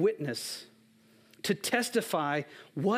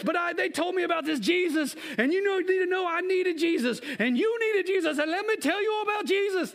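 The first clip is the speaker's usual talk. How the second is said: put-on Samoan accent; 10 semitones higher than usual. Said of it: "That's my Samoan accent".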